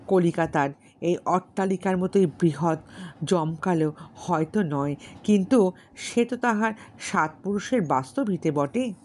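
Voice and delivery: ordinary delivery, nothing marked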